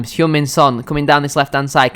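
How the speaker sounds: ordinary talk, nothing marked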